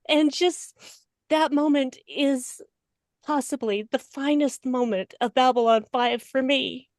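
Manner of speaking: ordinary talk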